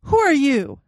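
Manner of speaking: frightened loud question; alarmed question. Acted. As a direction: challenge the unseen figure in alarm. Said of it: "I asked".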